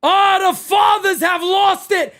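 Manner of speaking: ordinary talk, nothing marked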